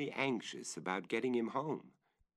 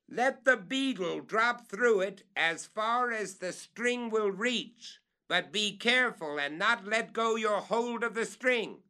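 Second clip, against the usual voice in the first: much higher, louder careful directions; calling up; 12 semitones higher, 5 dB louder than usual. Acted. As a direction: warn him to hold the string.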